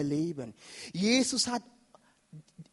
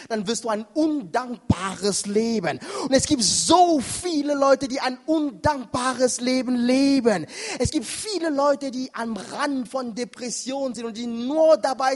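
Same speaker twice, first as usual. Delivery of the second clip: raised, emphatic sermon warning; forceful preaching; 5 semitones higher, 7 dB louder than usual. Acted. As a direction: press the warning on the congregation.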